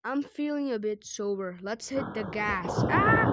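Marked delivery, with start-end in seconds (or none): 2.9-3.3 short, high, loud mock scream; hammed-up crash yell; pretend scream. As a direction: yelp loudly as if crashing.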